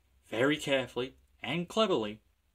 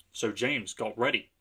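ordinary delivery

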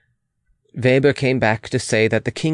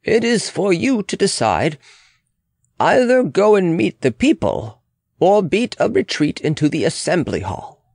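higher-pitched, insistent official's voice; urgent official; 7 semitones higher than usual. Acted. Official urging the Queen to decide, higher and insistent. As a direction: press the point in a raised pitch.

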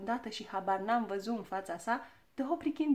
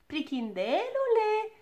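put-on high, drawn-out call of a little girl; teasing shout; 12 semitones higher, 5 dB louder, slower than usual. A narrator's reading. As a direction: call out loudly, stretching the word. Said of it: "strigă ea". (strigăt ascuțit, prelungit)

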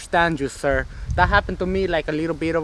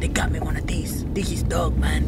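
whisper; 5 dB quieter than usual. Acted. Hiding from gang in back seat.

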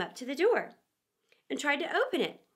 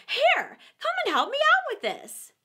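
high, louder scornful repeat; angry rabbit; 14 semitones higher, 6 dB louder than usual. A narrator's reading. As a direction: throw his words back at him, annoyed. Rabbit orders Pat.

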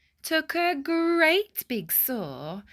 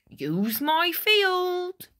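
high, slow, loud farmer voice; farmer's offer; 9 semitones higher, 4 dB louder, slower than usual. Acted. Farmer Seed offering his field, high and slow. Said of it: "Farmer Seed said".

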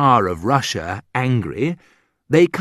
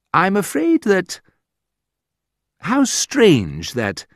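mock polite disdain; 10 semitones higher, slower than usual. Quoting terribly rude phrases.

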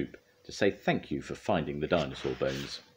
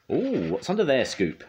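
high, loud nosy question; high-pitched inquisitive "oh"; curious; 10 semitones higher, 5 dB louder than usual. A narrator's reading. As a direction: pipe up with eager curiosity. Nosy Spud peeks under tarpaulin.

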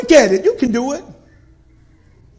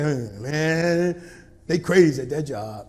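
low grumbling tired husband; grumpy grumble; 7 semitones lower, slower than usual. Acted. Tired spouse home from work, low grumbling. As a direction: mutter it like a worn-out worker.

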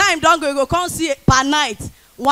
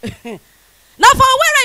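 laughing opener; 11 semitones higher, 6 dB louder, much slower than usual. Acted.

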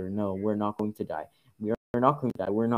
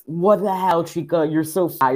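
exasperated; 6 semitones higher, 6 dB louder, slower than usual. Real, not acted. Scolding a game character.

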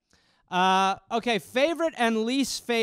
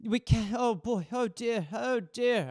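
mild fretful mother; 3 semitones higher than usual. Retold or imitated. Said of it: "She's just literally on the couch going".

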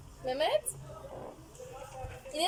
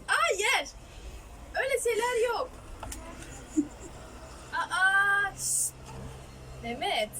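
raised, higher voice saying no sharply; firm scolding; 4 semitones higher, slower than usual. Real, not acted. Scolding toddler.

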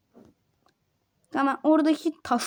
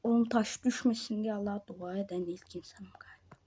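whisper; 6 semitones lower, 11 dB quieter, faster than usual. Real, not acted. Whispered aside during tense jump.

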